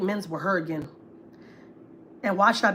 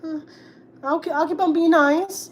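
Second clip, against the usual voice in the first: higher, slow, over-sweet compliance; hammed-up innocent yes-woman; naive mock agreement; 8 semitones higher, 4 dB louder than usual. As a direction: play the dumb pushover politely agreeing. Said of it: "Like a stupid ass".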